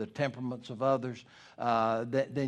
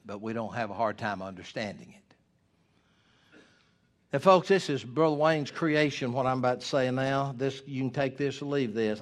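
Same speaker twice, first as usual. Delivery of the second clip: usual speaking voice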